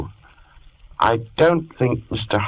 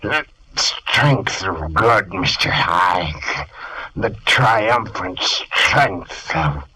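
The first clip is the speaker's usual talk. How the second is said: slow exultation; slower than usual. Acted. Jekyll exults over potion.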